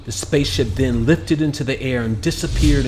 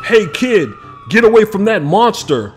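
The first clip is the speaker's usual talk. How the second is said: loud, higher shouted warning; yelled; 9 semitones higher, 6 dB louder than usual. A narrator's reading. Cop warning a boy off a dragon, yelling. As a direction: bark a warning at the kid. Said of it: "one cop yelled".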